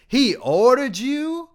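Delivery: very high, slow, drawn-out incredulity; outraged disbelief. A narrator's reading. Dean echoing the student's excuse, high incredulous drawl. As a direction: stretch it out in disbelief.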